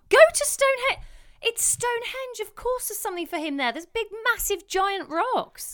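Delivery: high, excited disbelief; incredulous. Real, not acted. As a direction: pitch up in amazed disbelief. Mock outrage at friend's plan.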